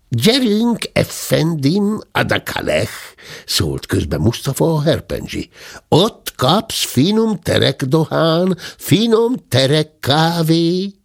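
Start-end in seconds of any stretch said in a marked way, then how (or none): 0.1-2.9 put-on Turkish accent. Mustafa the Turk speaks. 5.9-10.9 put-on Turkish accent. Mustafa praises Turkish goods.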